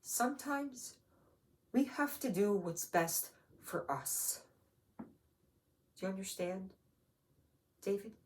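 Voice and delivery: quiet, careful pleading; sad mother. Acted. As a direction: break it gently to a child, with pauses. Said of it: "and she said".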